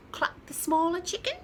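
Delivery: ordinary delivery, nothing marked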